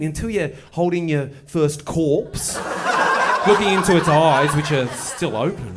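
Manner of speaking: laughing through a grim punchline. Real, not acted. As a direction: chuckle as you push the gruesome image. Dark joke, laughing through it.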